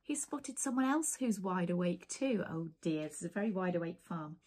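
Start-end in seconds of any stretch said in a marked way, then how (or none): none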